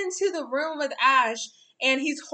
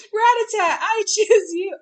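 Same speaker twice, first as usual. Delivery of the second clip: trainer calling out; 7 semitones higher, 8 dB louder than usual. Retold or imitated. Trainer throwing out a Rattata.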